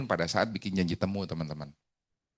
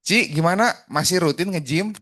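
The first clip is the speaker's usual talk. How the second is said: high, louder, upbeat check-in; friendly personal opener; 6 semitones higher, 5 dB louder than usual. Shown as a demonstration. Coach showing a better opener to a client about the gym, lively and friendly. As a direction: call out to them with lively interest. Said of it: "nanyanya kan jadi beda".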